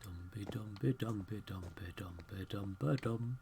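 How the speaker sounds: soft unvoiced whispered singsong under the breath. Real, not acted. Player whispering a nonsense ditty while exploring, whispered singsong. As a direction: whisper a little nonsense tune to yourself. Humming to self while playing.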